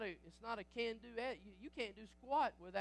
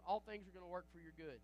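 ordinary talk, nothing marked

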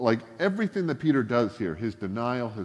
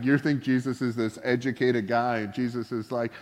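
ordinary talk

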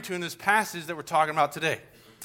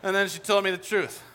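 ordinary talk, nothing marked

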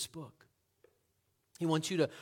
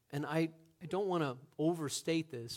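normal talking voice

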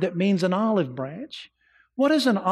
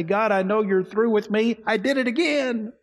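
a believer confessing, higher and pleading; guilty believer praying; 5 semitones higher than usual. Acted. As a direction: beg God as one who failed again. Voices a believer's guilty prayer.

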